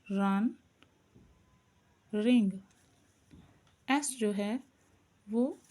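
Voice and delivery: ordinary talk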